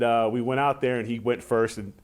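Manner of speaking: ordinary talk, nothing marked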